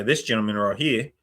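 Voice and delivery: Australian paramedic giving a report; Australian accent. Retold or imitated. Paramedic reporting to nursing staff in an Australian accent. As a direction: give the handover in an Australian accent. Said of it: "in the Australian accent".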